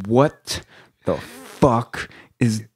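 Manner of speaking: slow, word-by-word mock disbelief; drawn-out, unshouted drill instructor disgust; not even yelling. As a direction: imitate an instructor, spacing each word out slowly. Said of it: "he's not even yelling".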